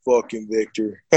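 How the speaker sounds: low mock star voice; Sylvester Stallone. Movie star reacting to the fighter's name, low imitated voice. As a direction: lightly imitate the star, pitched well down. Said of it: "Sly's gonna be like".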